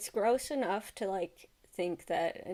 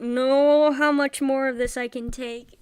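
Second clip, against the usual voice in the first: loudly fed up, at breaking point; loud, emphatic outburst of frustration; loud exasperation; 4 semitones higher, 11 dB louder than usual. Real, not acted. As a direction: raise your voice in exasperated disbelief. Fed up with creepy clip.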